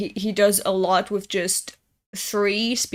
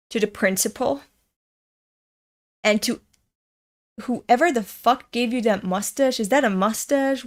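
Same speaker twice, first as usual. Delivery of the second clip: ordinary talk, nothing marked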